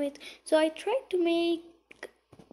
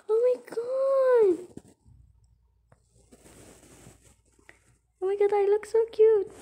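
high, thrilled gushing; excited squealing; 8 semitones higher, 5 dB louder than usual. Real, not acted. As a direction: squeal with delight, pitched well up. Still thrilled at baby look.

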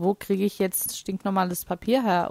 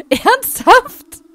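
much higher and louder, a squeaky cry; squealed disbelief; 11 dB louder than usual. Real, not acted. Gamer reacts to the toilet request with a squeal. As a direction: shriek the word in mock outrage.

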